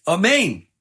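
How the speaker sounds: a loud, high, firm Amen; emphatic amen. Retold or imitated. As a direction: stamp the word Amen with conviction. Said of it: "made an emphatic".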